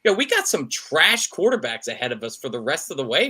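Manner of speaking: normal talking voice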